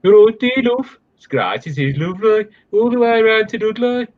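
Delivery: high-pitched, put-on Brummie; Brummie accent. Performing Susan's Brummie for a housemate, in a high Brummie accent. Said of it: "we've got Brummie accent from Susan".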